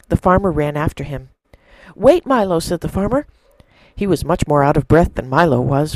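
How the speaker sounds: a preacher narrating a parable to the church, in an expressive storytelling voice; story narration. A narrator's reading. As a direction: tell it like a fireside story to the congregation. Sermon told as a story.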